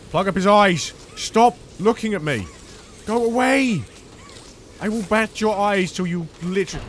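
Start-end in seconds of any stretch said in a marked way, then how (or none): 0.1-3.8 loud, angry shouts; angry yelling. Real, not acted. Player yelling at the machine to stop staring, angry shouts. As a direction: drive it away with fury.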